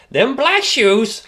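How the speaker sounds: high, slow squawking parrot voice. Retold or imitated. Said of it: "sounded like a parrot".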